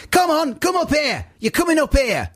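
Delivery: high-pitched hectoring woman calling people over; put-on high matriarch holler; loud matriarch. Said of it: "big matriarch, uh, loud woman".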